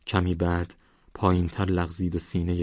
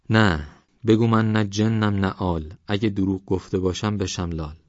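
dictating an oath; 2 semitones higher, 4 dB louder than usual. Acted. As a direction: spell out the oath for him to repeat. Old man dictates oath slowly.

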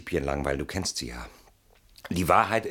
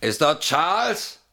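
higher, louder, slow, questioning rise; raised, drawn-out phone query; calling on the phone; 8 semitones higher, 4 dB louder, slower than usual. Acted. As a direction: call out to check who is on the line. Calling into a telephone.

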